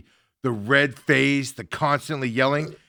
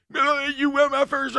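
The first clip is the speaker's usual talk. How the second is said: mock coach yelling; 12 semitones higher than usual. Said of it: "it's just like".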